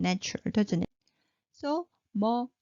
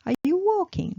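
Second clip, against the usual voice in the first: walking lifted high, a higher asking voice; question tune; 9 semitones higher, 5 dB louder than usual. Shown as a demonstration. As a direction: ask it brightly, pitch up. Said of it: "raise the tone".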